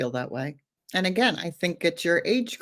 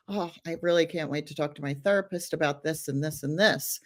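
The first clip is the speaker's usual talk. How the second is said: ordinary talk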